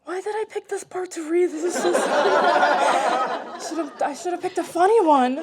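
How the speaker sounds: high, loud, laughing aside; real laughing. Real, not acted. Reader regrets choosing a sad part, high laughing complaint. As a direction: break up laughing as you lament it. Breaks off reading, laughing at herself.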